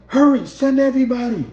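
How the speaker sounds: urgent shout. Retold or imitated. Driver begging the police to hurry, loud. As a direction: make it frantic.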